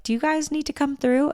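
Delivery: put-on high sweet old lady voice, gently asking. Old lady on the train asks tourists, high and sweet. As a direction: play a kindly old woman offering help. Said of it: "this sweet old lady is like".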